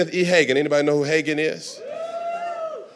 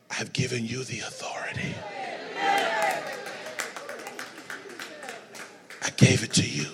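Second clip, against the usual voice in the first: whispered Lord; 12 dB quieter than usual. Acted. The Lord telling Hagin of his authority, whispered. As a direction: whisper it with solemn weight. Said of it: "the Lord whispers and says".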